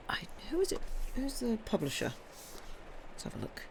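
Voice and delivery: hushed, half-voiced muttering while searching the book; whispering to herself. Real, not acted. Checking the book for the publisher, muttered in a whisper. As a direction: breathe the words to yourself as you look.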